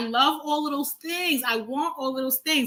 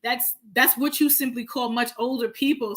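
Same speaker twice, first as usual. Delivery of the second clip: ordinary talk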